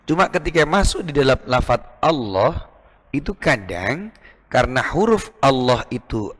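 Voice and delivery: normal talking voice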